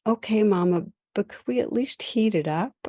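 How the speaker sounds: put-on a boy giving in calmly but pleading; tearful little son. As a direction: son asking his mom to at least heat the pizza, teary. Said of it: "with this tear-streaked voice".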